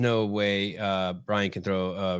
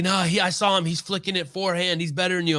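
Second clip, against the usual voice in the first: put-on kid insisting; 9 semitones higher than usual. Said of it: "He's like".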